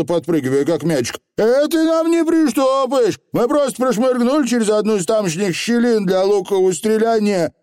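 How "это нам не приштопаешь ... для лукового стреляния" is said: high, excited dialect patter; little rogue pleading innocence; protesting Feegle. A narrator's reading. Feegle denying a break-in, high and indignant.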